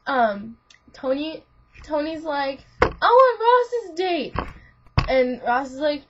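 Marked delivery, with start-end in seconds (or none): 2.8-4.3 high, loud, drawn-out demand; mock demanding. Tony demands a friend's date, mock insistent. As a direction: belt out a childish demand. Retold squabble over dates.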